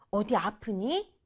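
ordinary talk

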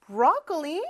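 ordinary talk, nothing marked

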